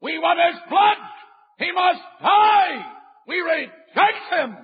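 high, shouted mob demand; preacher voicing the mob crying out for blood; mob outcry. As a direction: cry out for blood like the crowd. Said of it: "They cried out".

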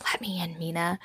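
hushed whispered plea. Acted. Simon pleads through the door to Mina, whispered.

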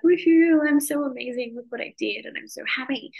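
loud woohoo, then mock bragging; mock self-congratulation. To her listeners, mock bragging about her win. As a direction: play a podcaster gloating over herself. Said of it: "sit here and be like".

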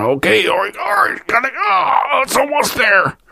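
high, strained, pained squeak; hammed-up straining voice, high and pained; mock straining on the toilet. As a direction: squeeze it out like a man on a bucket. Straining on a bucket in car.

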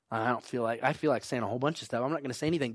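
quiet, low grumble of reluctance; withdrawn mutter. Acted. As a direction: mumble it to yourself, holding back. Voicing someone who holds words back.

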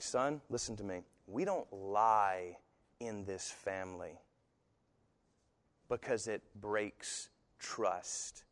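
dad voice. Retold or imitated. Father sternly warns his son about lying, slow quiet dad voice. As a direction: lay down the law slowly to your son. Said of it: "old dad's in his dad voice".

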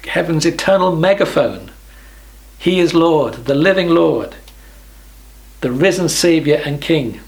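ordinary delivery

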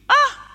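sharp high "ah" of realization; startled high exclamation; sudden high cry. Acted. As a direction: yelp as it clicks. Peter realizing he was hurt.

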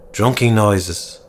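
usual speaking voice

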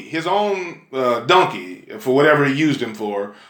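ordinary delivery

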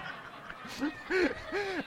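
real laugh at his own punchline; preacher cracking up; high burst of laughter. Real, not acted. Continued laughter.

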